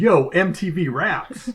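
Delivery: slow, high, loud hype call; drawn-out mock hype announcer; show-intro shout.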